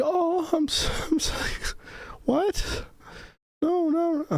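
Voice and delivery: very high-pitched retold dismay; imitating a taken-aback coworker, voice way up high; mock dismay. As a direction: squeak it out as the flustered coworker. Acts out coworker's upset reaction.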